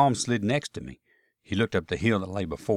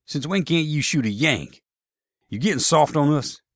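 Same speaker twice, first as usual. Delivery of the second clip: higher-pitched Southern soldier needling a comrade; challenging; 7 semitones higher, 5 dB louder than usual. Acted. Soldier challenges comrade who won't shoot.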